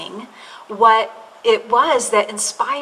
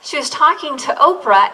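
ordinary talk, nothing marked

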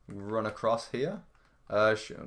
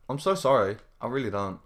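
ordinary delivery

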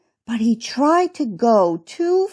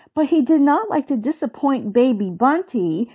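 plain reading voice

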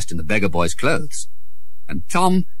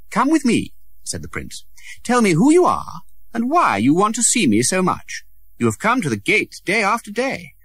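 lightly voiced young prince; 10 semitones higher than usual. Prince voice in reading.

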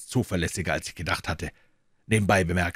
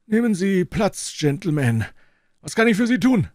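put-on a lofty old professor receiving guests; old professor's voice; 13 semitones higher, 6 dB louder than usual. A narrator's reading. Elderly professor politely greeting visitors, high and measured.

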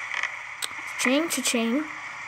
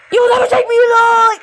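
louder, higher hammed-up action hero cry; mock defiant yell; 6 semitones higher, 7 dB louder than usual. Player fleeing enemies, hammed-up defiant shout. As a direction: shout it like a fugitive in a film.

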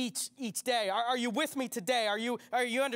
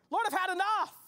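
cried out; 10 semitones higher, 3 dB louder than usual. Acted. Same words cried out earlier.

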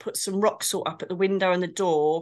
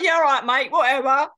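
mock-sceptical yeah right, pitched up and loud; sarcastic brush-off; 6 semitones higher, 6 dB louder than usual. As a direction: wave him off as if he's spinning a yarn. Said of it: "And I'm like".

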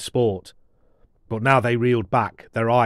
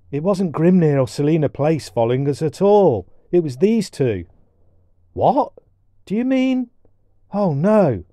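put-on young voices; 6 semitones higher, 5 dB louder than usual. Susan laughing, Colin shocked.

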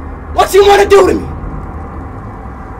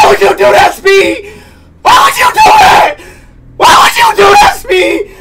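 distressed wail; 8 semitones higher than usual. Real, not acted. Distressed at game event.

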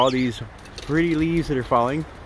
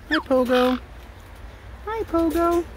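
high-pitched hello, higher on the repeat; pet-greeting voice; 13 semitones higher, slower than usual. Real, not acted. Owner saying hi to Pogo, high pet-greeting voice. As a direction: call to a pet high up in your voice.

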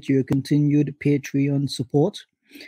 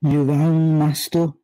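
loud, drawn-out butler's call; Addams Family impersonation; 4 dB louder, slower than usual. Acted. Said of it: "that was my Adam's family imperson".

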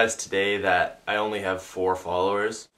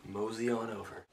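much softer, coaxing invitation; 12 dB quieter than usual. Acted. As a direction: coax them gently to come over.

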